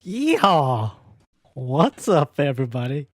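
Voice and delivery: loud yeehaw cowboy holler. Acted. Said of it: "I try to channel [name] in a fucking cowboy".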